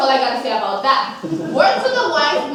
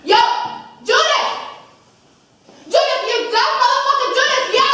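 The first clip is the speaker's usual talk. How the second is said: yelling at Judas; 9 semitones higher than usual. Retold or imitated. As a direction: holler at him to wake him up. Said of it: "so I did this".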